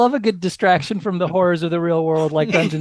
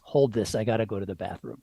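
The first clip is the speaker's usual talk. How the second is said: lower character voice; 7 semitones lower, 7 dB quieter than usual. Acted. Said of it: "Diendo hands his pan flute over to Guy English and says".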